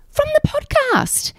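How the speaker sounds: very high, drawn-out delight; slow it down into a high fan squeal; imitated thrilled fan. Deli worker naming where she knows her from, imitated thrill.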